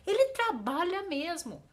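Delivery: high, loud punch on "trabalha"; emphatic intonation. Shown as a demonstration. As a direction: insist forcefully on "trabalha mesmo". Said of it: "faz essa entonação na voz".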